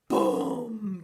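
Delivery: loud booming word; big boom. Shown as a demonstration. Reader showing children a bear's fall, loud boom. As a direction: drop it like a bear hitting the ground. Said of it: "big boom sound".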